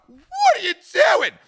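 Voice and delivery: very high, loud, slow alarmed cry; shocked what. Retold or imitated. Teller to Katie lighting up near security, high shocked cry. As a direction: shriek it in disbelief. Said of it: "And I'm like".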